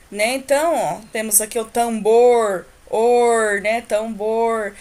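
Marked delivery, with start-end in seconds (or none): 1.6-3.6 "tambor, or" with "bor" and "or" held on a curled R; curled-tongue R. Shown as a demonstration. Teacher isolating the sound in "tambor, or", drawn-out demonstration. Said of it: "a língua dá uma entortada". 3.8-4.7 "Tambor" with the last syllable held on a curled R; curled-tongue R. Shown as a demonstration. Teacher repeating "Tambor" for children, drawn-out demonstration. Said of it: "a língua dá uma entortada".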